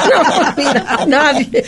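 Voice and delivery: laughing through the words. Real, not acted. Laughing while telling friends nobody keeps her company. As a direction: giggle it out.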